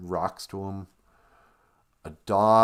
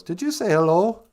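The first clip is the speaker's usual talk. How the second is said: high, louder questioning "hello" with a clear H; mock Sarah; 10 semitones higher, 6 dB louder than usual. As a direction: echo "hello" back in a raised, querying voice. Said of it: "And she goes".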